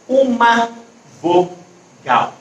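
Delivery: UMA VOGAL broken by pauses, u-ma, vo-gal; emphatic pauses. Shown as a demonstration. Teacher stressing the rule to pupils, paused uma vogal. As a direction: set uma apart, then vogal in slow separate beats. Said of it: "a gente só tem".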